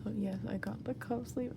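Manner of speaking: ordinary talk, nothing marked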